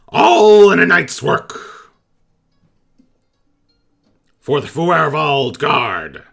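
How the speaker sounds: high, loud, proud goblin voice; goblin captain. Acted. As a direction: bellow it like a pompous little goblin captain.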